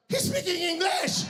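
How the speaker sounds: put-on high-pitched, indignant Filipino-accented cry; exasperated Filipino interpreter. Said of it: "And the interpreter's like".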